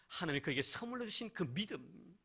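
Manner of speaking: ordinary talk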